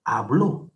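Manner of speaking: "Habló" con golpe de voz en "bló"; acento en la última sílaba. Shown as a demonstration. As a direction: carga la fuerza en el final de "Habló". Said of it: "termina en la última sílaba". ("Habló", marcando la última sílaba)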